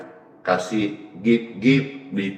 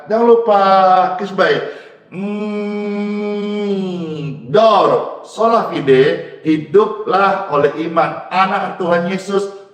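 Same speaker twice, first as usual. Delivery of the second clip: high, exuberant farewell calls; playful excited sign-off; 9 semitones higher, 4 dB louder than usual. Real, not acted. Pastor saying goodbye to livestream viewers, excited playful calls.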